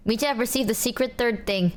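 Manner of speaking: ordinary talk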